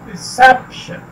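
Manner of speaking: Reception with the weight on SEP; re-SEP-tion, middle syllable punched in reception; stress on SEP. Shown as a demonstration. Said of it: "stress किया जाता है वो सेप है".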